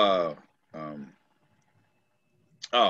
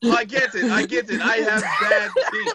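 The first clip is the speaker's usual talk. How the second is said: high, louder joking British voice; mock British caricature; 9 semitones higher, 5 dB louder than usual.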